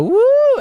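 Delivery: loud, high-pitched "woo"; crowd cheer. Retold or imitated. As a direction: imitate one whooping audience member. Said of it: "at least noise".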